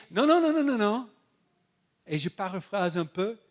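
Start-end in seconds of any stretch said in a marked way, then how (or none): 0.1-1.1 high, rapid string of noes; emphatic refusal. Acted. The Lord turning down Paul's prayer, emphatic refusal. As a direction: cut the plea off, loud and high.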